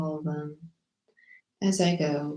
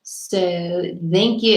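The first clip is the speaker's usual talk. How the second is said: usual speaking voice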